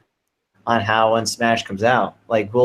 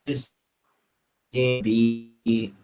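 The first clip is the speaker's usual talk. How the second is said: bad connection; 5 dB quieter, much slower than usual. Real, not acted. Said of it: "[name], you're breaking up".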